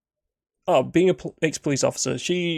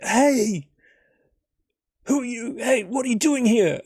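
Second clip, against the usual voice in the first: sharp, high call of a startled lookout; challenging stranger; 10 semitones higher, 3 dB louder than usual. Acted. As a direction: demand who he is, voice raised high.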